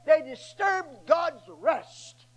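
"They disturbed God's rest" drawn out slowly, each word weighted; slow emphatic. Acted. Preacher driving home his point, slow and weighted. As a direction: lean on every word, slowly.

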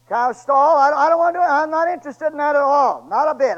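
put-on city boy loudly refusing farm chores; emphatic refusal. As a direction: insist you want nothing to do with it. Preacher mocking farm work, loud.

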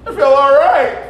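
high, loud laughing voice. Real, not acted. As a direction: squeeze the words out through laughter. Laughing quote of himself.

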